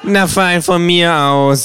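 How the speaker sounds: grudging kangaroo. Acted. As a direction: give in with mock reluctance.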